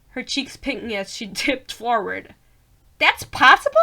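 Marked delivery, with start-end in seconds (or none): 3.0-3.8 high, loud put-on astonishment; exaggerated high-voiced heroine asking in disbelief; Poppy's voice. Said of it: "I think she talks like that".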